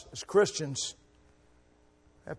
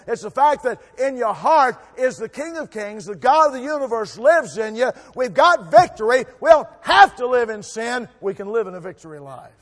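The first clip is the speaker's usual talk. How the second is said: loud, rising sermon cry, much louder at the end; preaching at full force; 6 semitones higher, 10 dB louder than usual. Acted. Preacher rallying the congregation to stand, loud and fervent. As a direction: shout the victory to the church.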